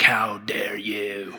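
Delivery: hushed, low, drawn-out indignation; playful imitation of someone deeply offended; mock affronted. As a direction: stretch it out in a scandalized whisper. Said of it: "and they're like".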